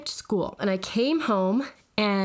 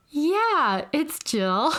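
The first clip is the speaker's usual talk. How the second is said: lightly raised pitch, trying to sound like sister; a hint of innocent sister voice; pretending to be Jill; 6 semitones higher, much slower than usual. Sister on the phone faking her sibling's voice, mild. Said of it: "And I was like".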